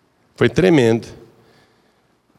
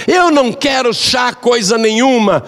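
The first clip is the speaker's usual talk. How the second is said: angry refusal; 10 semitones higher than usual. Acted. Mother-in-law refuses the second tea, shouting high and angry. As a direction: bark the refusal at her.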